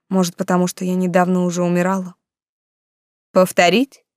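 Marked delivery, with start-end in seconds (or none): 3.3-4.0 exaggerated politeness with menace; pointedly polite. A narrator's reading. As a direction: ask with pointed courtesy. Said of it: "подчёркнуто любезно поинтересовался маг".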